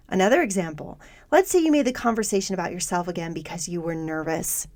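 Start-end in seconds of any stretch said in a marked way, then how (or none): none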